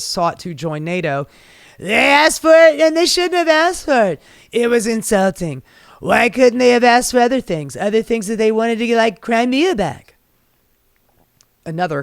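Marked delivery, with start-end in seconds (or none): 1.8-10.1 Trump impression. Host mocking Trump on Ukraine and NATO, whiny Trump voice. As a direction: whine it like an offended Trump. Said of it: "Trump declared that".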